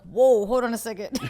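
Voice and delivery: retold comic protest; startled objection. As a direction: put the brakes on in surprise.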